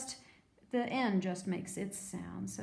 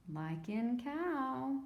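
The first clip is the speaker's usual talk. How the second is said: ordinary talk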